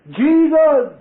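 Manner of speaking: high, loud fervent cry; shouting Jesus. Retold or imitated. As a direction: call out the name with all you have. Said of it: "And I shouted".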